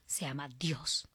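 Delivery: whispered surrender. Retold or imitated. Retold surrender to God.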